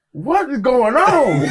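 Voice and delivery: high, loud, drawn-out disbelief; exaggerated astonished question; mock bafflement. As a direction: imitate a baffled crowd, stretching it out slowly. Mimics everybody's baffled reaction.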